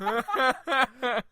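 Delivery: short amused laughing burst; burst of laughter. Real, not acted. Host amused by the action figure idea, laughing.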